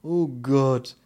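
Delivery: loud, groaned exclamation; exasperated little man's outburst; dismayed bald man. Acted. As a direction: cry out in dismay. Bald man despairs at the news.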